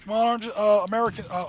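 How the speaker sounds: loud, high call to the dice; cheering the dice. Real, not acted. Player shouting encouragement at his dice, loud and high. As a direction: yell at the dice to come through.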